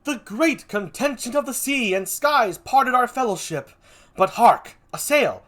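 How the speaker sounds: excited, high theatrical declamation; Cassio. Acted. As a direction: declaim it, then break off at a sudden sighting. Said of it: "Cassio says".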